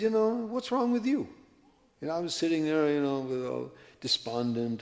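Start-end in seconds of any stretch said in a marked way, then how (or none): 0.0-1.3 villager's voice. Retold or imitated. Villager asks the sitting traveler.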